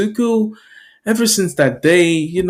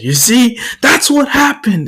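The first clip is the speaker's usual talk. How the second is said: high, emphatic telling-off; angry bully scolding; 9 semitones higher, 4 dB louder than usual. Acted. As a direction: scold the kid loudly and sharply.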